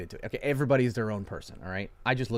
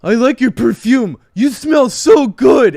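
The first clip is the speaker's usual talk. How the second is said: high, loud, slow hammed-up creep; mock creepy guy; 12 semitones higher, 18 dB louder, slower than usual. Mocking what a creep says on a first date, loud and high. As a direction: overdo it like a leering creep. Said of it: "You can't just be like".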